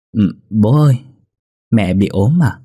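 put-on a small child tugging at his father, innocent; small boy asking. Boy asks his father about mom, in a light child's voice.

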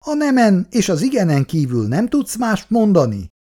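high-pitched indignant scolding; exasperated father's reproach; indignant outburst. A narrator's reading. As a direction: flare up at a daughter. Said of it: "fortyant föl Szabó".

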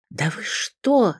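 high, drawn-out, awed disbelief; a flutter of starstruck amazement, slowly stretched; fluttering awe. A narrator's reading. Secretary hearing of the pianist friend, fluttering. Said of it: "затрепетала секретарша".

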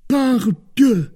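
loud, high-pitched oath; startled exclamation. A narrator's reading. Porthos entering to his friends with news of leave, startled exclamation. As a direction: burst out with it in surprise.

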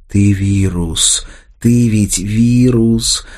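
very slow, drawn-out words; slow accusation. A narrator's reading. Anya tells Arsen he is a virus, slow accusation. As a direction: stretch each word out, weighty.

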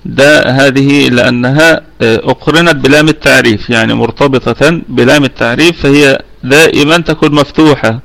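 usual speaking voice

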